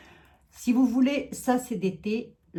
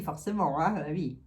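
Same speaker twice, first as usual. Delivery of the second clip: ordinary delivery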